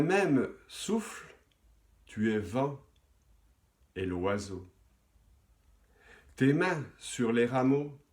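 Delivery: measured poem reading with long pauses; poetry recitation. Acted. Host reciting a listener's poem to the audience, measured poetry recitation. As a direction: recite verse phrase by phrase, letting key words swell.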